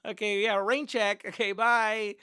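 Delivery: high, loud woman imitation; woman declining. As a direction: breeze off with a mock-cheery goodbye. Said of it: "She's like".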